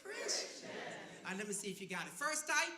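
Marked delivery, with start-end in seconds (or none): 0.0-0.6 "Christians" said high, sweet and polite; real polite. Shown as a demonstration. Pastor coaching the crowd, "Christians" said sweetly polite. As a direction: say "Christians" politely, pitch lifted well up. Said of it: "Can you say it real polite?".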